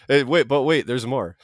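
hyped TV pitchman; infomercial announcer. Pretend. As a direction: sell it like a late-night ad. Mocking infomercial catchphrase.

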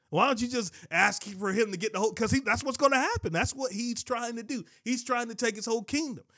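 impassioned preacher voice; rising preaching. Real, not acted. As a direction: drive the point home from the pulpit.

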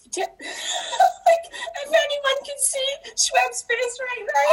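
words breaking up in a high, slow laugh; helpless laughter. Real, not acted. Guest cracking up at the host's baffled face, helpless laughter.

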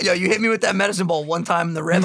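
ordinary delivery

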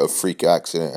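usual speaking voice

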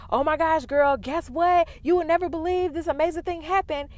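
high, loud, bubbly phone-call squeal; gushing great news. Acted. Friend calling her friend with great news, gushing. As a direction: burst out with thrilling news to a girlfriend. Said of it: "you just got some great news".

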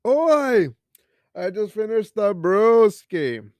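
loud, high, drawn-out holler; hollered announcement. Pretend. Host announcing the finished beer, boisterous high holler. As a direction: proclaim it loudly, stretching the words.

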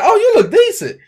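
"decent" in a high, mock-polite voice; her sweet delivery. Man mimicking how co-host softened the compliment, high-pitched. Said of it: "how she said it".

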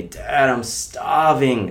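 playful imitation of a melodramatic child; dramatic kid. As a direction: drag out "starving" like a hammed-up hungry child. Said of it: "they use this very dramatic, colourful kind of language".

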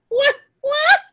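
high, loud incredulous whats; loud disbelief. Retold or imitated. Friend yelling at a baby name in disbelief. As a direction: yelp it in disbelief. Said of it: "I was like".

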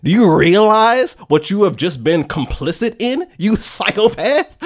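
hammed-up incredulous berating; scolding voice in head. Voice in head berating character, mock outrage. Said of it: "the voice in their head starts talking".

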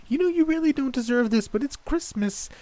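high, thin, strained granny; barely holding back anger. A narrator's reading. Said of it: "she said thinly".

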